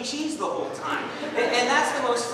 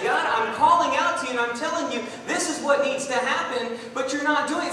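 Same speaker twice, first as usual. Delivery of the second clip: higher and louder, pleading and accusing; impassioned cry to God; 5 semitones higher, 5 dB louder than usual. Acted. Prophet's prayer voiced in sermon.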